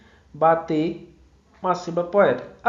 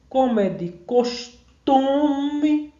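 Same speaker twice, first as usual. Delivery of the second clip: drawn-out syllable-by-syllable reading, higher on the held syllable; verse scansion; 5 semitones higher, much slower than usual. Shown as a demonstration. Teacher counting poetic syllables for students, slow scansion. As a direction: stretch the verse out slowly, syllable by syllable.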